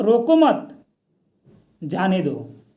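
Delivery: group "रोको मत" together, break, then let "जाने दो" drop; comma pause after मत. Shown as a demonstration. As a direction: pause firmly between "मत" and "जाने दो". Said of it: "ये सब कोमा के चलते".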